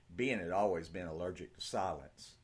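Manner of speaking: usual speaking voice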